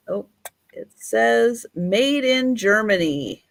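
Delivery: ordinary talk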